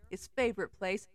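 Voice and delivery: ordinary delivery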